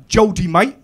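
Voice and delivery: loud, high Southern English heckle; mock Southern English. Southerner accosts comic at the bar, mock Southern English. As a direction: call it out in a mock Southern accent. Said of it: "this Southern prick comes over".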